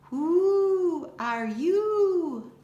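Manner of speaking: high, loud, very slow hooting owl. Acted. Tawny owl hooting a question at the girls, drawn-out hoot. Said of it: "She hoots a question".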